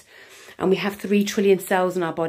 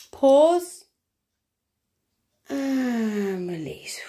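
guided breathing meditation; 4 semitones higher than usual. Acted. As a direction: count them gently through each breath.

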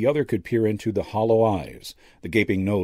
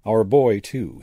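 plain reading voice

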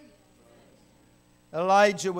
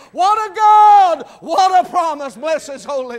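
exultant shout; 8 semitones higher, 13 dB louder than usual. Acted. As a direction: shout praise at the top of the sermon. Exultant preaching peak.

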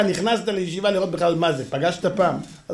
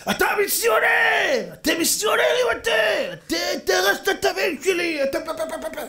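furious shouting, imitated; 12 semitones higher than usual. Bereaved father accusing the rabbi, imitated high shouting. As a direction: screech accusations, breaking into gibberish. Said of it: "אחרי שהפסיק לצעוק".

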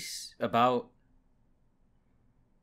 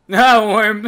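higher, louder amused protest; loud mock cry; 6 semitones higher, 11 dB louder than usual. Real, not acted. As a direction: call it out with a laugh. Reacting to scene.

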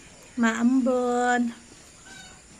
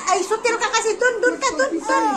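high, repeated shooing; scolding; 7 semitones higher, faster than usual. Real, not acted. Mother sending naughty child away, scolding.

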